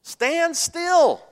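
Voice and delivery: high, loud command; commanding Moses. Acted. Moses ordering the frightened people, loud and high. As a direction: bark the order to hold firm. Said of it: "Moses said unto the people".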